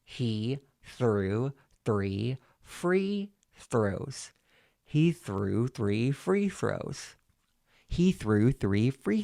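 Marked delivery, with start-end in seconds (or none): 0.0-4.3 very slow, separated syllables; staccato with pen in teeth. Shown as a demonstration. Coach demonstrating the second tongue twister, slow staccato. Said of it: "staccato with the pen in your mouth". 4.8-7.2 slow, joined-up words; legato with pen in teeth. Shown as a demonstration. Coach demonstrating the second tongue twister, slow legato. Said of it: "legato with the pen in your mouth".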